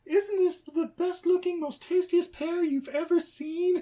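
high singsong enticement; coaxing pet talk. Acted. Owner tempting Buddy with a pear, high coaxing. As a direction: talk to him like a toddler, high and sweet.

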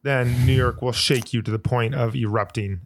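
ordinary delivery

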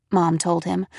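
plain reading voice

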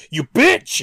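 loud, high furious yell; sudden scream. Pretend. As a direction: snap into a shrieking rage.

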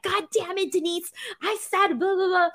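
high-pitched mock scolding, trailing into blah blah; imitating mom's scolding, half-joking. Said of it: "She would scold me".

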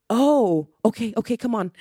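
acted-out surprised backing down; retold sudden realisation. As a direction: imitate someone caught out and giving in. Usher realises her mistake, gives in.

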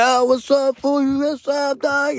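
high, excited hey-bro greeting. Retold or imitated. Older friends greet him in the truck, high and excited. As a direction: greet the kid like your hype homie. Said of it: "they're all excited".

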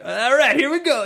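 high, loud "let's do this"; eager pep-up. Acted. Host acting out his own training montage, eager and loud. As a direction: psych yourself up loudly, pitched high.

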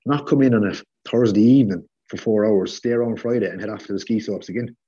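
usual speaking voice

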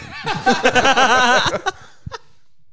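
high, loud burst of laughter. Real, not acted. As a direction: let out a big laugh. Big laugh after story.

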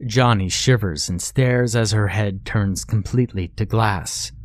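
usual speaking voice

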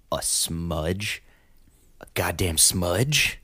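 slow, drawn-out mock disbelief; mock gravity. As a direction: dwell heavily on each word in mock outrage. Mock disbelief over a tiny smudge.

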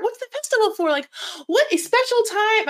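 high, dreamy princess voice; Disney princess. Pretend. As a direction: gush like a fairy-tale princess. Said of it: "I'm like a Disney princess".

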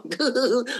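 high-pitched imitated childish giggle; little-kid giggle. Imitating an excited young player in an interview, high giggle. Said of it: "like a little kid giggling".

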